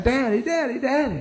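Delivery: put-on high, thin wail of a toddler calling for daddy; mock a clinging child crying for daddy; imitating crying toddler. Preacher imitating a toddler screaming for daddy, high mock wail. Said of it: "start screaming and crying".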